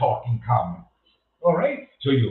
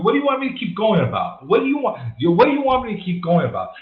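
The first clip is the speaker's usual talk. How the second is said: raised, louder exasperated pushback; 5 semitones higher, 4 dB louder, faster than usual. Real, not acted. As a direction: push back at the chat, fed up. Exasperated streamer answering chat.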